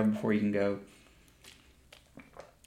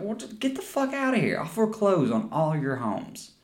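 put-on much higher, sneering spoiled rich kid; mock rich brat; 7 semitones higher than usual. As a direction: sneer it like an entitled rich boy. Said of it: "It's like".

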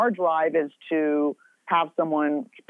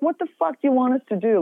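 exasperated retort; 8 semitones higher, faster than usual. Retold or imitated. Snapping back at the judging diners, exasperated. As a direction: throw up your hands at them. Retort about screaming toddler.

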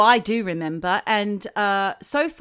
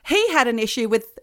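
normal talking voice

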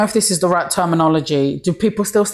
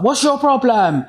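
higher-pitched mock challenge; retold confrontation; 5 semitones higher than usual. Comedian retelling a reply to a heckler at the bar, mock confrontation. Acted quarrel with man at bar.

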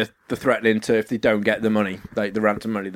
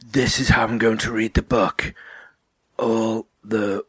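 put-on slow, drawn-out hushed old-man narration; ponderous old narrator, half whispered; narrator impression; 3 semitones lower, slower than usual. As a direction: read it painfully slowly, word by word. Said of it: "Like this".

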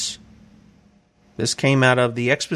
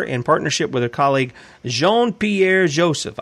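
normal talking voice

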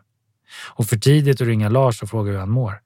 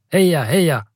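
higher cheerful shout across the track; cheering call; 6 semitones higher, 3 dB louder than usual. A narrator's reading. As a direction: call out encouragement to a passing runner. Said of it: "och ropar".

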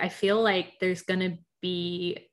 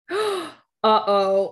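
alarmed gasp; 2 semitones higher, 7 dB louder than usual. Real, not acted. Gasps at a pairing of castmates.

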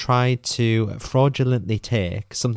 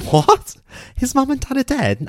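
much higher, louder replay of her own shock; shocked disbelief; 4 dB louder than usual. Retold or imitated. Retelling her reaction to the ex-wives, a high stunned what. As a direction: squeak it out, stunned.

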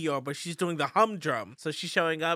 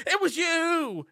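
high, slow, drawn-out accusation; dramatic accusation; 10 semitones higher, 4 dB louder, slower than usual. Retold or imitated. Lars blaming Carolyn for her son's death, dramatic accusation. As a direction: stretch the last word theatrically.

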